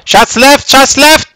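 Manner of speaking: urgent high shouted callout; combat callout. Real, not acted. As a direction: yell the warning over gunfire. Firefight callout to squad.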